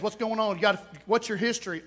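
ordinary talk

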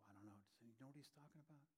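hushed, fast, confused murmur; whispering disciples. Retold or imitated. Disciples whispering to each other, hushed and puzzled. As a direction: drop to a hushed aside, fast. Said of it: "can you just hear him whisper".

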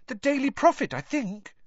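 very high, eager little voice; piping young fan; squeaky schoolboy. A narrator's reading. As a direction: answer helpfully in a boy's squeak. Colin answers in squeaky voice.

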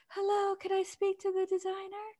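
high, soft, breathy-free polite caller; caller woman voice. Retold or imitated. Retelling her mother's story, high polite voice of the caller. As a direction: put on a dainty high lady's voice. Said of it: "this woman who said".

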